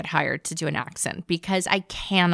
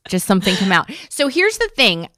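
usual speaking voice

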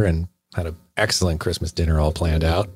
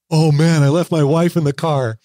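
a lightly voiced old man, higher and louder, in a panic; panicked old man; 7 semitones higher, 3 dB louder than usual. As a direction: blurt it out like a frazzled old man who just remembered. Panicked old man racing downstairs.

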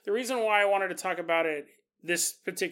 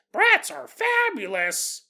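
high, slow, louder doll voice; talking doll; 9 semitones higher, 5 dB louder, slower than usual. Pretend. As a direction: chirp it like a toy's catchphrase. Doll catchphrase voice.

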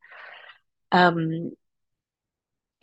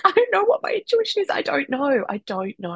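rushed and much higher, then fading to a defeated mumble; panicked new mum; 8 semitones higher than usual. Retold or imitated. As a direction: blurt it out in a panic, then lose steam. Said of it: "I was like".

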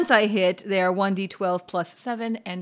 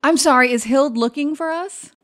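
raised, louder polite question; Tira in character; 6 semitones higher, 7 dB louder than usual. Acted. Tira politely asks the servant about Hild, raised and louder. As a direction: speak up clearly to a servant.

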